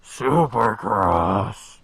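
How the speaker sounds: drawn-out loud raspy announcer call. Shown as a demonstration. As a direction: stretch it like an arena hype man. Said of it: "That's how you have to say it".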